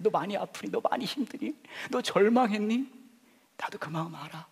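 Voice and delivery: high pleading questions easing to a whisper; pleading Jesus voice, then whisper. Acted. Jesus asking the hurting congregation, pleading then hushed.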